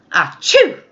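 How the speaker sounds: a big high comic achoo; loud sneeze. Acted. Elephant's final surprise, a loud achoo sneeze. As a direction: blast out a sneeze.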